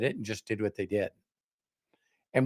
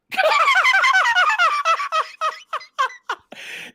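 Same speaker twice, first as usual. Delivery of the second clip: loud high laugh; 7 dB louder than usual. Real, not acted. Laughs at own joke.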